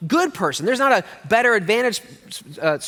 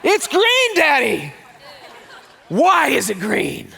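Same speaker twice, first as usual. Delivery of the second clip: put-on high, loud child's voice that drops at the end; high excited kid voice fading into a laugh; amazed little boy; 9 semitones higher, 3 dB louder, slower than usual. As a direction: sound like a four-year-old showing off. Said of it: "He goes".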